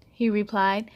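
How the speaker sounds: usual speaking voice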